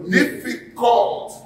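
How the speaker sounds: "Difficult" drawn out slowly on a high pitch; emphatic stress. Real, not acted. Preacher underlining a word for the congregation, emphatic "Difficult". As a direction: stretch "Difficult" high, hammering it home.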